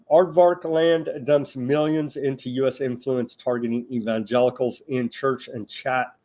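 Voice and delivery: ordinary talk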